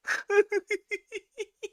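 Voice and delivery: shrieking laugh; high helpless laugh. Real, not acted. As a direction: lose it completely. Cracks up at texts.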